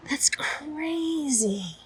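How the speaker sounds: slow whispered amazement; hushed stunned whisper; awed whisper. Real, not acted. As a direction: whisper it in disbelief. Amazed at price.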